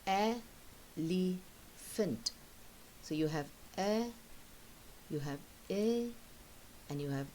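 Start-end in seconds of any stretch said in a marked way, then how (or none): 0.1-2.3 very slow, split into syllables; teacher sounding out elephant. Shown as a demonstration. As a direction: stretch elephant into its el-e-phant syllables. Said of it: "So see".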